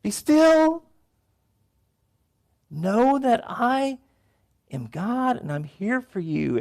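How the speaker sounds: high, slow, sustained call to be still; God's voice. Retold or imitated. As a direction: call out stop then settle gently. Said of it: "God is saying".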